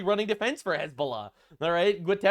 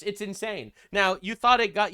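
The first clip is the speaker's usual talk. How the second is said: ordinary delivery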